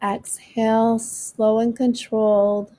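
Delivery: slow, calm breathing guide; guided meditation. Acted. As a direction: ease them through each breath.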